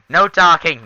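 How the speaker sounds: high, loud mock command; mock stern order. Gamer laying down a chat rule, mock stern order. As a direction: call out a playful ban.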